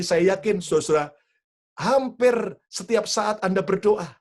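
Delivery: ordinary talk, nothing marked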